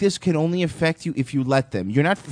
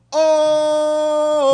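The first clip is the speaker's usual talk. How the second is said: long, high-pitched "ohhh" wail; drawn-out high holler over the others; mock outcry; 14 semitones higher than usual. Host jeering at the racism claim, mock outcry.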